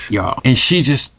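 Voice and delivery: ordinary talk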